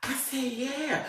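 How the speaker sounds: sheepish mumble. Retold or imitated. Admitting it to the officer, soft and sheepish. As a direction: admit it under your breath.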